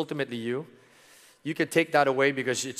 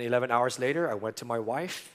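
ordinary talk

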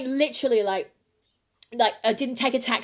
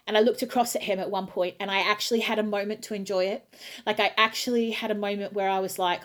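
ordinary talk, nothing marked

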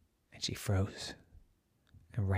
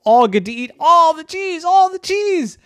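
loud, very high delighted call; gleeful high cheer; 12 dB louder than usual. Acted. Cheddar cheers to the cats about eating cheese, high and gleeful. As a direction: sell the cheese with glee.

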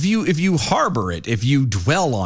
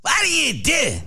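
Chris Rock; 14 semitones higher than usual. Retold or imitated. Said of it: "you're just screaming at a high pitch".

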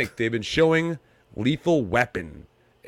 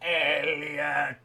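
strained, alien-like call; 2 semitones higher, 7 dB quieter than usual. Pretend. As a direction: croak it like E.T. E.T. calling out.

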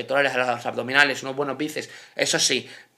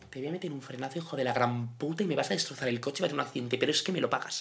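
muttered threat; 4 semitones lower, 11 dB quieter than usual. Retold or imitated. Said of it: "hay veces que digo".

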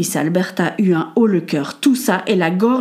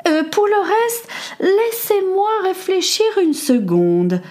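much higher, slow, airy lady voice; Lady Amelia pondering aloud; high society lady; 12 semitones higher, slower than usual. Acted. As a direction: float it up like a grand hostess.

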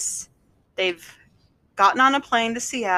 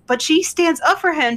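ordinary delivery

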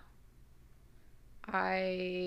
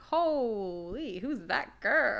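surprised; 3 semitones higher, 4 dB louder, much slower than usual. Retold or imitated. Quoting herself at the mirror.

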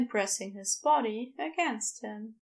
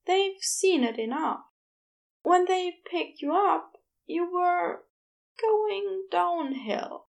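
higher, gentle Aziraphale explaining; higher Aziraphale telling Crowley what happened; 6 semitones higher, 4 dB louder than usual. A narrator's reading. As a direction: raise the pitch and soften the bad news.

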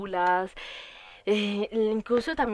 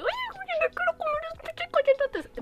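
squeaky gush. Retold or imitated. Fan gushing over a cute idol's cheeks, high squeal. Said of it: "muy como que".

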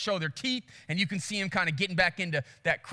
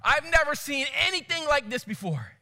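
amazed guide; 6 semitones higher than usual. Retold or imitated. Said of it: "the South African guy".

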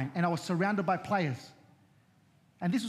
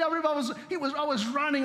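ordinary talk, nothing marked

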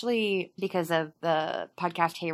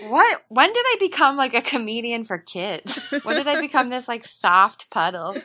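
mock outraged; 5 semitones higher, 5 dB louder than usual. Real, not acted. Mock outrage at being seen soft.

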